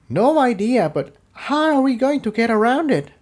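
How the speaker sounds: a worried pony asking what now; worried companion. A narrator's reading. Tiptoe answers, worried.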